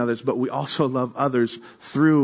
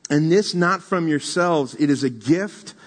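raised, slow emphatic reading; scripture reading; 4 semitones higher than usual. Acted. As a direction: proclaim the verse with weight. Reads scripture aloud.

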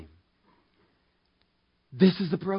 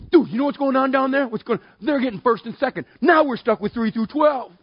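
higher, louder excited gossip; gossiping disciple; 7 semitones higher, 4 dB louder than usual. Retold or imitated. Disciple rushes back to tell the others, excited gossip. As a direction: spill the news to your buddies.

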